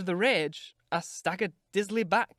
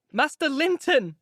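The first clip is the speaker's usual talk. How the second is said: higher, loud, slow call; crying out; 9 semitones higher, 6 dB louder, slower than usual. Acted. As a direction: call him out in alarm. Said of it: "I cried".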